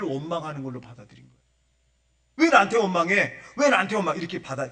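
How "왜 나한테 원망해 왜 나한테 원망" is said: loud, higher indignant challenge; acting out Moses taking it personally; angry protest. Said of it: "그러니까 화가 난 거예요".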